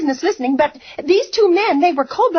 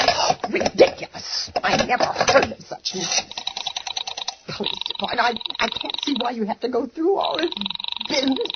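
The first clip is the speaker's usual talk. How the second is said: low, softer grumble under her breath; muttering to herself; 5 semitones lower, 6 dB quieter than usual. Acted. Invalid mutters to herself while waiting for the police, low and soft. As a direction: mutter it to yourself in disgust.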